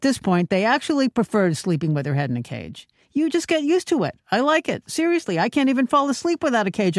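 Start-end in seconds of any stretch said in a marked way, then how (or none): 3.1-7.0 very high, insistent mock voice; mock a fashion victim in denial, pitched way up; pouf devotee. Pouf devotee defending her head cage, high mocking voice. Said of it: "I can just hear the conversations".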